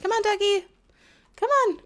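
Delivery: high, loud cheering on; cheering a pet duck to move; excited urging. Real, not acted. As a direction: egg the duck on.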